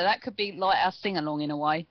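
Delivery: ordinary talk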